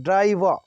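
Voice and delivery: ड्राइवर ending in "vaa", R dropped; American silent R. Shown as a demonstration. Teacher drilling the word, silent R. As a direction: finish ड्राइवर on an open "aa". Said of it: "वा करके आ".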